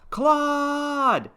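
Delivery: high sharp warning shout. A narrator's reading. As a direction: call out the alarm. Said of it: "had to do was shout".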